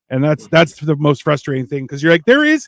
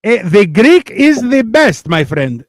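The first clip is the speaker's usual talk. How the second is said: hammed-up Greek accent, pitched up; 8 semitones higher, 5 dB louder than usual. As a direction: play up a proud Greek accent. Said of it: "your Greek accent".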